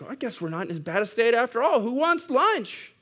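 high, eager clueless congregant piping up; oblivious guy in back. Acted. Clueless guy at the back cheering the sermon on, oblivious and high. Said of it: "imagine the guy in the back".

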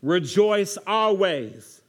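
slow, weighty reading; scripture reading. Acted. Pastor reading verse sixteen to the congregation, slow. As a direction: read each word with weight. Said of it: "Starting at verse sixteen".

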